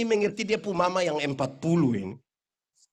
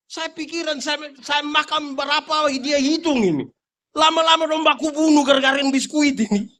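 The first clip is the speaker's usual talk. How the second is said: louder and higher, building preaching climax; mounting exclamation; 6 semitones higher, 8 dB louder than usual. Real, not acted. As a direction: build to a comic outburst. (semakin keras dan bersemangat)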